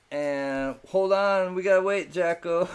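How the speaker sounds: high, loud call to hold the bidding; loud call to wait. Real, not acted. Host halting the auction for Jacko, calling out loudly. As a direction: stretch out hold on, then call out loud to stop everyone.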